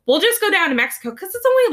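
lightly voiced fed-up parent; mock angry parent. Parent threatening misbehaving kids with Mexico, mildly mad. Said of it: "being really mad and being like".

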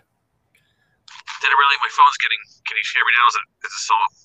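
tinny distorted phone audio; sounding like a Star Wars robot; tin can phone. Real, not acted. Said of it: "It's very tinny".

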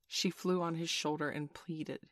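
ordinary delivery

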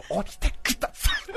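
unvoiced, pleading hush; the secretary whispering at the closing door. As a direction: whisper the plea to get off. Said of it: "小声で話してる".

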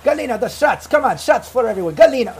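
loud high call across the room; yelling for Galina. Acted. Boris calls Galina to bring shots for everyone, loud yell. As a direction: shout for her to bring the shots. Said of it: "he kinda yells out".

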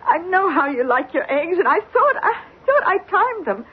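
tearful, high-pitched protest; crying. Acted. Housekeeper explains the runny eggs to her employer, crying. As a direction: plead your case through tears. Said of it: "Don't cry about it".

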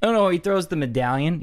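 usual speaking voice

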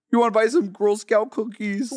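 lightly voiced pop diva impression. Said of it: "we're gonna retire Miss [name]".

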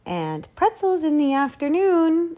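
high, lofty grand lady voice; imperious plummy grande dame. Acted. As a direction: sweep it out like a duchess. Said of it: "said the Duchess".

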